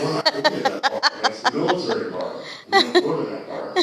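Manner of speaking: laughing, emphatic no; adamant refusal. Retold or imitated. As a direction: put your foot down, half laughing. Firm refusal to girlfriend, retold.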